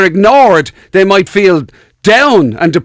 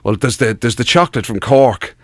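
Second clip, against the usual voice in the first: much lower, softer, musing voice; mock patient on couch; 9 semitones lower, 4 dB quieter than usual. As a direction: friend mimicked telling a psychiatrist, low and soft. Imitating friend on therapist couch.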